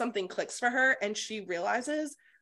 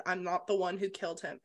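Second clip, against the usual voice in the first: ordinary talk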